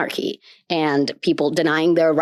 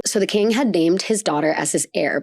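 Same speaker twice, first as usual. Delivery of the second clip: ordinary talk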